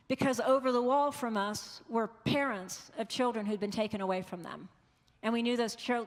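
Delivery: normal talking voice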